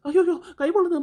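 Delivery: sharp high yelp of pain as the hand burns; pained cry. Acted. Hand burning, crying out.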